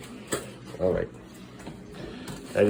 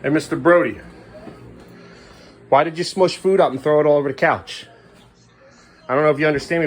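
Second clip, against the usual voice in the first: loud, raised-pitch telling-off; stern parental scolding; 7 semitones higher, 5 dB louder than usual. Real, not acted. Confronts child about thrown food.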